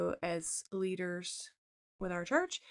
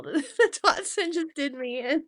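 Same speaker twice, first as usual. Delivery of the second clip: high, loud, teary-laughing confession; seriously crying; 5 semitones higher, 7 dB louder than usual. Real, not acted. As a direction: overcome with happy tears, voice rising. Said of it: "I'm, like, seriously crying".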